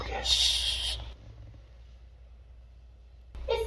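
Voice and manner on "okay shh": soft unvoiced shush. Real, not acted. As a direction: hush the room gently. Kitten asleep, hushing.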